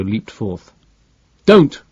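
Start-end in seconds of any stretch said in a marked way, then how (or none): 1.4-1.8 loud, high shout of warning. A narrator's reading. Sherrinford warns Barbro off, loud high shout. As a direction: stop her with a yell. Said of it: "Sherrinford shouted".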